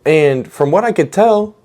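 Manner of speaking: usual speaking voice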